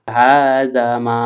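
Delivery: very slow, drawn-out tilawat; slow recitation. Acted. Teacher draws out the words for students, slowly. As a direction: stretch each syllable.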